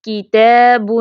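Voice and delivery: "کتاب" recited with a held, thin "aa"; thin-letter elongation. Shown as a demonstration. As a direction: stretch the alif in "کتاب" lightly. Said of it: "باریک حروف کے لیے تلفظ اَ". ("کتاب" کا الف باریک اور لمبا)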